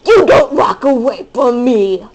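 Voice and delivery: loud indignant yell; hammed-up outrage; mock angry shout. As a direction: scold them for leaving. Mock scolding game character.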